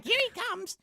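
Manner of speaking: high creepy creature voice; creepy whispery spider. Retold or imitated. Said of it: "Spider voice".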